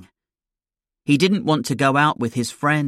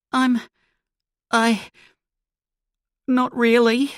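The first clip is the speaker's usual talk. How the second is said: put-on shaken boy; 10 semitones higher than usual. Shaken boy after robbery.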